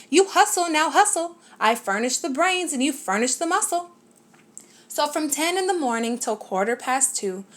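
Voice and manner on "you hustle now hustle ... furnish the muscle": lightly voiced bug ordering the elephant about; pushy little bug barking orders; bossing. A narrator's reading. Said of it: "just sat there and bossed him".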